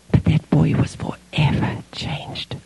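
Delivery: slow, breathy hush; whispered awe at the change. Real, not acted. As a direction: let it sink in softly. Solemn end of the story.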